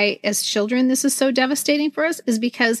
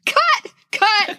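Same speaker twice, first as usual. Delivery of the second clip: laughing through tears; 10 semitones higher than usual. Real, not acted. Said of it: "I'm gonna start crying now".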